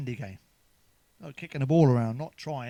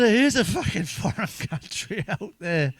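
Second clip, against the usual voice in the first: laughing retort; 5 semitones higher, 5 dB louder, slower than usual. Real, not acted. Retorting to his mum, laughing.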